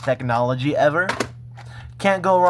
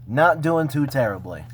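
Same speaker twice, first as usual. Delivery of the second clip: normal talking voice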